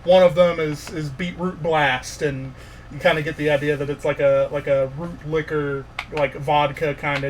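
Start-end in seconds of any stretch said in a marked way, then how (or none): none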